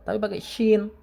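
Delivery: ordinary talk, nothing marked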